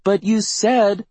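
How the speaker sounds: put-on high, drawn-out little-girl protest; high-pitched child's complaint. A narrator's reading. Child protests once more.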